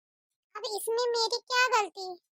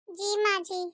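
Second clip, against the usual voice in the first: ordinary talk, nothing marked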